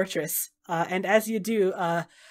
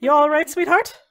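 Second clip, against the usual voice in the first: a little louder, lightly voiced caring check-in; caring goddess; 5 semitones higher, 5 dB louder than usual. Raven Queen checks on the stunned dragon, lightly caring. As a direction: ask after her like a fond mother.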